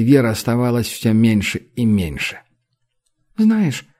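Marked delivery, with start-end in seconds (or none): 3.4-3.9 sleepy murmur. Acted. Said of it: "сонно пробормотала Ук".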